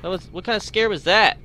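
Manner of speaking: loud high shaken question; scared horror game streamer. Real, not acted. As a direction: shaken after a jump scare. After jump scare.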